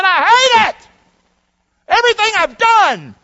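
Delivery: high, loud, fast anguished king voice; bitter king. Acted. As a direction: spit out his disgust with his labor.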